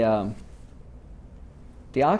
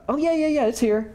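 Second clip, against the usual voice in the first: much higher, eager imitation; eager musicians. Imitating band members hurrying to answer Leonard, much higher. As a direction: pitch it way up like keen session players.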